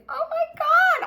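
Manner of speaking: high, loud oh my God; exclamation. Real, not acted. Flight attendant recalls her old thoughts, exclaiming loudly. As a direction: burst out in disbelief.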